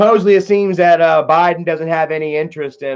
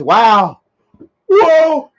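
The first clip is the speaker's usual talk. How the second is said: loud drawn-out whoa, higher and louder; reading a chat whoa; 3 semitones higher, 7 dB louder than usual. Retold or imitated. Streamer reading a fan's chat aloud, loud whoa. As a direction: belt the whoa like a sitcom catchphrase. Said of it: "Troop and Shumi says".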